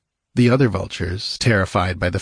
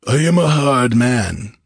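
slow, louder, emphatic boast; hard outlaw chief; 4 semitones higher, 5 dB louder than usual. A narrator's reading. Outlaw chief testing a crucified captive, hard and emphatic. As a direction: declare it slowly with authority.

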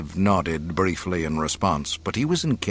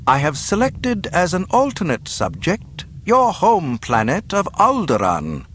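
higher-pitched, formal pronouncement of doom; menacing governor; 8 semitones higher, 3 dB louder than usual. Acted. Governor naming the captive's home planet as target, formal menace. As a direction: announce the sentence with relish.

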